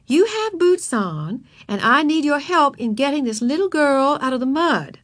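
lightly voiced teacher making her case; insistent teacher. A narrator's reading. Teacher asks boy to help stuck girl, insistent. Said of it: "Miss Binny pointed out".

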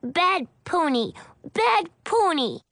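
high, loud scolding cry. A narrator's reading. Little girl scolding her stumbling pony, crying out loudly. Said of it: "she cries".

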